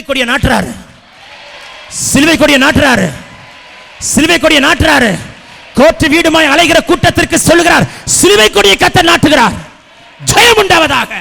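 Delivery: loud prophetic proclamation; ringing declaration of victory; full-force preaching. Real, not acted. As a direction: shout it out over the congregation.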